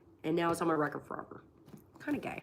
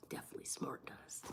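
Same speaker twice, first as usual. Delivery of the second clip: soft unvoiced whisper; conspiratorial whisper; 19 dB quieter than usual. Vlogger joking to chat about conspiracy, whispered. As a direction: whisper it like a secret.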